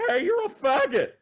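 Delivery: put-on high, loud kid taunt; squeaky kid voice. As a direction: squeak it out like a ten-year-old prank caller. High-pitched, squeaky kind of voices.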